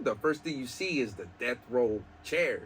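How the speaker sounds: ordinary talk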